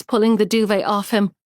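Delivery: ordinary delivery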